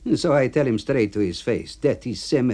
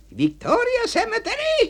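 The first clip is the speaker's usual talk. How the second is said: much higher yelled question; outraged yell. Acted. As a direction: blow up at the name. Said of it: "he's yelling".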